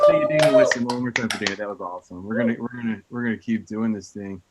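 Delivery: normal talking voice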